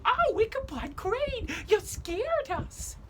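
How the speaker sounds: put-on high, slow child voices; a schoolmaster mimicking the frightened children; scared children. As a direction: squeal like frightened kids. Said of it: "the children would go".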